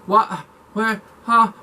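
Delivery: mock-startled double take, what what huh; stunned surprise. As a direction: act like the news just hit you. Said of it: "And I was like".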